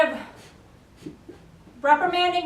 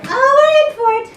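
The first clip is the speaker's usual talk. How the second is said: high and loud, bracing for chaos; excited exclaim; 10 semitones higher, 10 dB louder than usual. Real, not acted. Trainer watching dog grab the leash, loud excited exclaim. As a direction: yelp it out, thrilled and alarmed.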